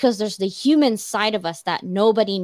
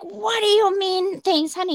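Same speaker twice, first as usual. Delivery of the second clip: much higher, indignant mock outrage; retold angry husband; 12 semitones higher than usual. Husband reacts to wife's lukewarm thanks, mock indignant. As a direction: imitate his offended disbelief, pitched way up. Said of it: "He was pissed".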